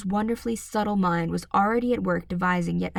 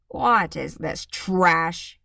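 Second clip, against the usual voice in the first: slow, louder, scornful father; a bullying dad sneering at her book; slow contemptuous growl of a father; angry father; 4 dB louder, much slower than usual. A narrator's reading. Father voiced by narrator.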